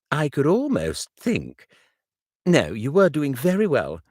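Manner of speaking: put-on young friend asking kindly.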